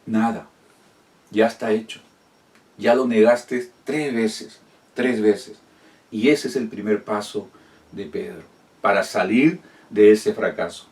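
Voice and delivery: ordinary delivery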